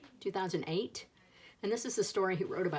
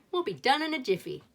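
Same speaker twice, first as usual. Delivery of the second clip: higher, louder, bright eager promise; eager reassurance; 6 semitones higher, 6 dB louder than usual. A narrator's reading. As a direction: sell it like a confident big sister.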